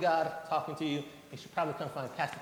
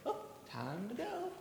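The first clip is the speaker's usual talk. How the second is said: put-on high, soft little-girl voice with a laugh; lightly voicing the daughter who knows it's time; child voice; 8 semitones higher, 9 dB quieter than usual. Daughter hearing her dad's call, high giggly voice.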